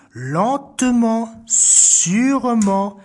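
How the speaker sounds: very slow, stretched words; creeping slowly. A narrator's reading. Narrator miming the thief's creep, very slow. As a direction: draw each word out like tiptoeing.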